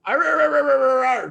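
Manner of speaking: high, loud frustrated arr sounds at a driver; hammed-up angry snarling at someone who cut her off; mock road rage growl. Driver cut her off, she snarls in mock road rage.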